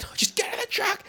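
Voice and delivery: high-pitched, urgent mock yell. Host imitates man hurrying woman with bag, mock yell. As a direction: cut her off with an exasperated yelled order. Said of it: "Just yells at this woman".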